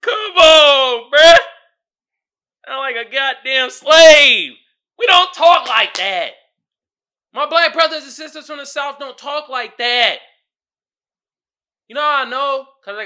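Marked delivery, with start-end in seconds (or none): 0.0-4.5 high, loud, drawn-out pleading; loud high-pitched protest; exasperated shout. Real, not acted. Exasperated at the clip. 5.0-10.2 loud, high, emphatic objection; raised-voice indignation; indignant protest. Real, not acted. Protesting the accent.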